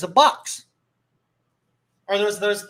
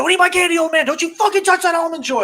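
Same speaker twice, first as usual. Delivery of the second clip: high, loud hollered warning; hammed-up furious outburst; mock angry yell; 10 semitones higher, 7 dB louder than usual. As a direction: holler like a possessive candy thief. Acted dad yelling at kids.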